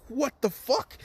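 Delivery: squeaky-high acted disbelief; mock shock. Whole class reacts in shock.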